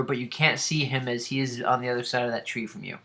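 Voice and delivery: usual speaking voice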